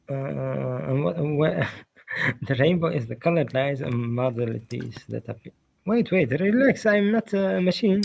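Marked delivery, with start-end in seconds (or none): 5.8-8.0 higher-pitched, pleading for patience; exasperated protest. Real, not acted. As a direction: push back at being rushed, voice raised in pitch. Rushed debater pushes back.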